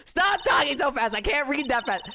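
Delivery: high panicked yelling; frantic shout. Real, not acted. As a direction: shout at the text to stop. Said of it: "she's talking too fast".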